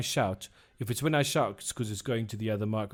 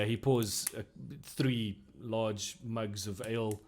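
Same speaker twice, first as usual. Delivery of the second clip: usual speaking voice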